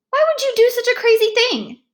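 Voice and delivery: put-on shrill disbelief at the kid's stunt; shocked manager. Car wash manager scolds the soaked boy, high and loud.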